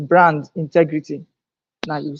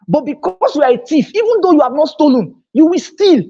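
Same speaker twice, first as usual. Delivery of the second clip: much higher and louder, climaxing on steal; emphatic repeat; 9 semitones higher, 4 dB louder than usual. Acted. Speaker repeating his thief point to the seminar, louder and climactic. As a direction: hammer it home the second time. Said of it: "Let me say it again".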